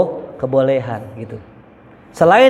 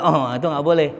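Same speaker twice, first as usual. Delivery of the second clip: usual speaking voice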